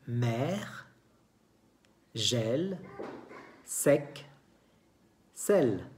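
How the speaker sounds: "mer, gel, sec, sel" one by one with open E; open E sound. Shown as a demonstration. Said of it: "se escucha que hay un sonido abierto". ("mer, gel, sec, sel" con e abierta)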